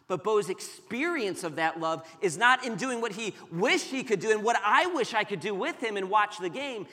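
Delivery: normal talking voice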